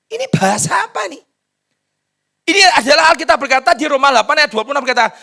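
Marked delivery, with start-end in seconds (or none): 0.1-1.2 high, voiced cry of bewilderment; incredulous high-pitched question; astonished scientist. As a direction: ask it aloud in amazement, pitched right up. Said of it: "Saintis bilang".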